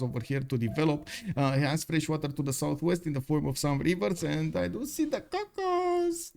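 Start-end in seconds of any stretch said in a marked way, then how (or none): 4.2-6.3 playful sing-song. Real, not acted. Caster savouring a tile.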